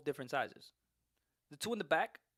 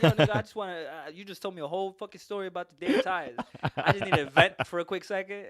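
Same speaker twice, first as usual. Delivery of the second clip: loud, exasperated listener; annoyed listener; 4 semitones higher, 8 dB louder than usual. Retold or imitated. An imagined listener annoyed by the tire story, loud and fed up. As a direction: complain that the story was too long. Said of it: "They're like".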